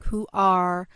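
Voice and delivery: ordinary delivery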